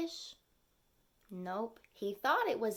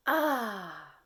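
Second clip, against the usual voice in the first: a contented sigh of ah; satisfied ah; 4 dB louder than usual. Acted. Turtle after a big gulp, a satisfied ah. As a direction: sound pleased after a good meal. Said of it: "expected to hear a satisfied".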